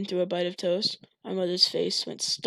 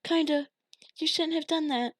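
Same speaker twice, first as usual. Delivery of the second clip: put-on child voice; 8 semitones higher than usual. Child voice, high.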